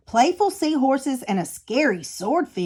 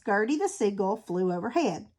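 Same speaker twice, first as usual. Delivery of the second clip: plain reading voice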